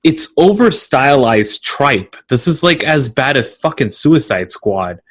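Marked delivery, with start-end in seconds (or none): none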